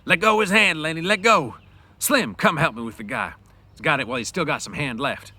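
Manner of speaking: loud, high pleading shouts; urgent shout. Acted. George stops Lenny crushing the hand, urgent shout. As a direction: shout him off the man. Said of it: "George shouted over and over".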